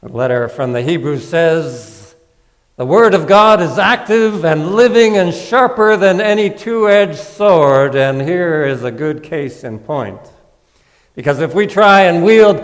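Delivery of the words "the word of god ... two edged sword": louder, declaiming the verse; forceful scripture quote. Acted. Preacher quoting Hebrews to the congregation, loud and declaiming. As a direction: proclaim it like a raised sword.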